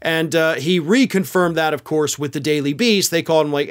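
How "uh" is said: ordinary talk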